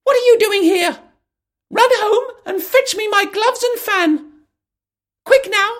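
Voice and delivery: high, loud, far squeaky rabbit voice. Acted. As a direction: snap orders like a flustered rabbit. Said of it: "the rabbit's voice".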